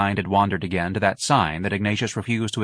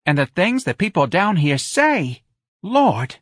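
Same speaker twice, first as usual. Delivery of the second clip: high-pitched, animated Southern local; gossiping old neighbor woman; 9 semitones higher, 3 dB louder than usual. Acted. Woman griping about what people down here say, indignant. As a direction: complain like a scandalized old gossip.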